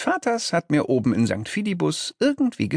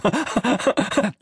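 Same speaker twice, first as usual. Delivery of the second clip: high, silly giggling; high-pitched underling's snicker; demon giggle; 12 semitones higher than usual. A narrator's reading. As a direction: giggle gleefully at your own nasty idea. Demon giggles at own idea.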